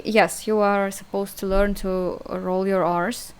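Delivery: ordinary talk, nothing marked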